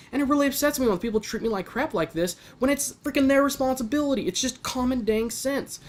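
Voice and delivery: venting, voice climbing as it heats up; getting mad. Real, not acted. Son ranting to camera about family, getting really mad. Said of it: "I'm getting a little bit mad".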